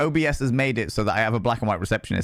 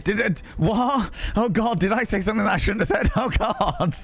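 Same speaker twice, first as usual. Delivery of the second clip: mock panic; 7 semitones higher than usual. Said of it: "And I'm like".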